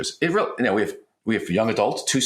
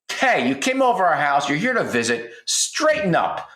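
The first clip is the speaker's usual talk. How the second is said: stern scolding; 7 semitones higher than usual. Retold or imitated. Grandfather scolding his upset grandson, raised and stern. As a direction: snap the boy back into line.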